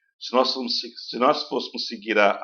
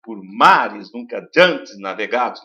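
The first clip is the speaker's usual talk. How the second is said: slow, louder, open vowels stressed; recited verse; 6 dB louder, slower than usual. Shown as a demonstration. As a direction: recite the line leaning on the A. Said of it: "trabalho das vogais abertas".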